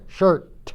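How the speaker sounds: "shirt" with its final "t" popped out; clearly released T. Shown as a demonstration. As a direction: model "shirt" with an unmistakable ending T. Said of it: "si no se pronuncia la T". ("shirt" con una T final muy clara)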